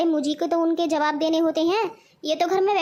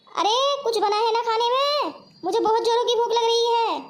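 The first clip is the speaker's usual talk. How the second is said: hungry calling out; 5 semitones higher than usual. Acted. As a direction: call through the house to be served. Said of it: "इतनी जोर जोर से क्यों चिल्ला रहे हो".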